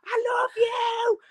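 high, drawn-out adoring squeal; excited fan yell. Retold or imitated. Fan calling to the star on stage, high squealed yell. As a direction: re-enact a gushing fan's cry. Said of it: "and then I go".

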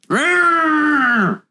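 squealing high mock roar; playful monster growl. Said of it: "Growl at us".

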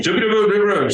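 high, sing-song imitation of foreign chatter; mock foreign gibberish. Security lady barking orders at a passenger, in high mock gibberish. Mimicking Russian lady's gibberish.

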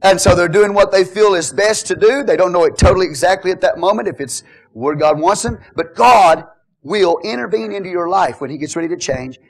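normal talking voice

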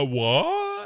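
high, baffled questioning squeak; exaggerated comic disbelief; mock bewildered. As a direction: play a stunned, stammered reaction. Acting out the stunned mayor's reply.

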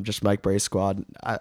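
usual speaking voice

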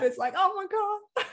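high giddy gasp. Real, not acted. Guest thrilled by the retreat, giddy. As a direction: gush with goosebumps. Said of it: "I have already goosebumps".

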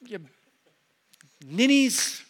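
high-pitched mock dad outburst; re-enacted yell. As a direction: act out blowing up at squabbling kids. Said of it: "I blow up at the dining room table and I yell".

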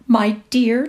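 lilting, gushing greeting; Kitty Maitland trilling. A narrator's reading. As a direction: trill it out gaily. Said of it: "trilled Kitty Maitland".

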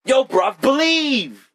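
hammed-up London accent, high and bright; British slang. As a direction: toss off a jokey British street voice. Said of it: "bruv over there is, like, bro for us".

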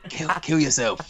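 a quick put-on voice for the taunt; mock taunt. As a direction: toss it off in a silly character voice. Quoting the Buddha bot's taunt.

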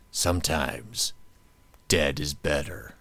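slow, low ominous line; slow eerie quote. Retold or imitated. Host quoting Pet Sematary for cohost, slow eerie. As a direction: let it hang like a creepy old neighbour.

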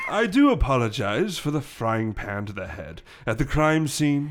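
put-on polite old English gentleman; older English accent. Gorilla apologizing to the detective, older English accent. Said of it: "starting with a older English accent".

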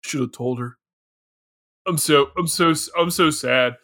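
low, deadpan grief; unfeeling mock sadness. Co-host mocking the lazy actor's grief, deadpan. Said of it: "it's not like he's tearing up".

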